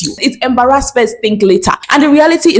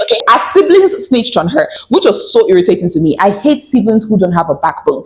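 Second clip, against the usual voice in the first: ordinary delivery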